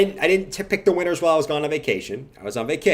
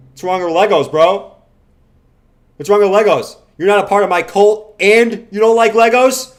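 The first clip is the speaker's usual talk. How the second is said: mock outrage; 5 semitones higher, 8 dB louder than usual.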